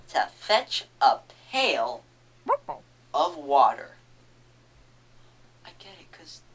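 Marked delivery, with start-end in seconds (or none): 5.6-6.4 whispering. Real, not acted. Whispered aside about pun.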